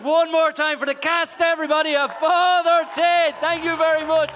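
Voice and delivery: hyped applause call. Real, not acted. Host rallying applause for the cast.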